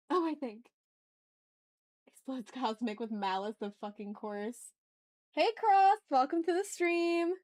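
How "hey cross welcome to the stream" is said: excited greeting. Real, not acted. Greeting a new chatter.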